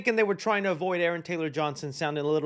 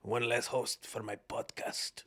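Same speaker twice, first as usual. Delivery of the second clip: low, soft, gruff mock-macho mutter; deadpan tough-guy voice under the breath; hammed-up callous father, muttered low; Russell Crowe impression; 7 semitones lower, 11 dB quieter than usual. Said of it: "A guy was just probably thinking".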